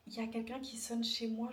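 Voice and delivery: normal talking voice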